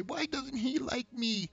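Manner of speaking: high, drawn-out mock whine; needy whine. Needy guy going to a girl, whining. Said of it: "some needy ass shit".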